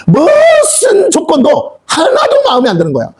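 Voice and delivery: slow, high laughing remark. Real, not acted. Preacher laughing at the grumbling servants.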